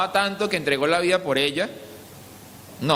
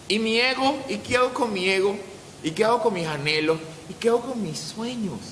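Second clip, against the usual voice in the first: high-pitched, plaintive string of questions; worried, high-pitched pleading; anxious protest; 8 semitones higher than usual. Acted. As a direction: voice a believer fretting over what they must give up. Acting out a distressed believer's protest.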